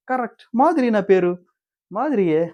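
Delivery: high-pitched mock pride; imitating a celebrity naming herself; acted self-important declaration. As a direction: announce it grandly, pitch soaring. Acted proud announcement of her name.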